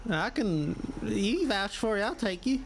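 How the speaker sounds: slow backwoods drawl, spelling name; hillbilly elf voice. Acted.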